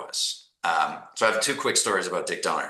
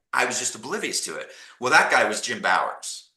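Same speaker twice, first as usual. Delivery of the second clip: usual speaking voice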